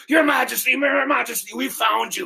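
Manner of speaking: high, breathless-rush hail to a sovereign; acted shout of frantic relief; urgent calling out. Guards find their missing king, calling out excitedly.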